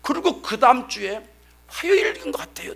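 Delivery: normal talking voice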